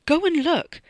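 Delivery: high, loud desperate command; frantic urging. A narrator's reading. Freddy points wildly behind him, frantically urging. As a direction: urge him away, pitched right up. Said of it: "pointing wildly behind him".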